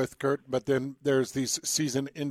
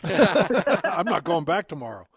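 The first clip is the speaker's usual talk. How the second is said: laughing; 4 semitones higher, 4 dB louder, slower than usual. Real, not acted. Joking about the gym after a workout.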